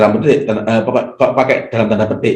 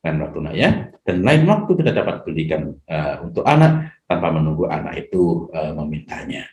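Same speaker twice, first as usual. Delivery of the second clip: put-on soft, kind promise to a child; gentle parent; 5 semitones lower, 4 dB quieter than usual. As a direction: speak softly and patiently, quieter. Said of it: "Jadi kita tetap mengatakan". (ayah berbicara lembut kepada anak)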